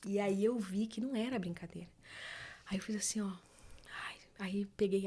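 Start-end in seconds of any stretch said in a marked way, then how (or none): none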